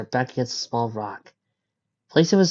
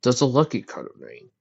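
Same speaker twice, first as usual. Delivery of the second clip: ordinary delivery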